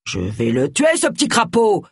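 grumble, then flare up in exasperation; growled threat. A narrator's reading. Vicar threatening Roy outside locked bathroom, growled threat. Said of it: "grogna le pasteur".

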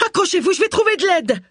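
very high, desperate plea; frantic cry for a dying man; panicked yelling. A narrator's reading. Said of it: "hurla Agatha".

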